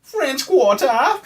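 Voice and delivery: high, slow goofy Sandler-style voice; Adam Sandler voice. Pretend. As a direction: stretch it out in a silly high voice. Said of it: "That's like my Adam Sandler voice".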